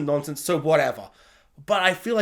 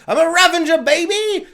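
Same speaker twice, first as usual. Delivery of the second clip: loud, high mock proclamation; hammed-up brash boast; 10 semitones higher, 8 dB louder than usual. Gamora telling Peter Quill off, jokingly brash and gleeful. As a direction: crow it, deliberately wrong for the sad scene. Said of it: "not like that, like, in a really sad way".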